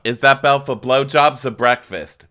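high, loud call upstairs; mock yell. [name] mocking Frank's talk by yelling up, loud high call. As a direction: holler it up the stairs in a put-on voice. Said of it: "I yell up".

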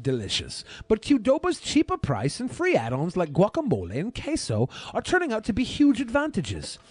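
measured, deliberate review narration; a hint of a British accent in the read; food review voiceover. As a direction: read it like a taste-test video host. Reads his food review voiceover.

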